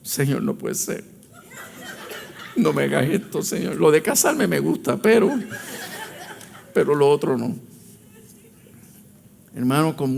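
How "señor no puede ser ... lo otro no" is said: laughing plea. Retold or imitated. Laughing while retelling complaint to God.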